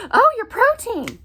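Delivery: high, loud fussing "Oh, your protein"; mock concern. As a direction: ham up the worried busybody. Mocking people's protein worry.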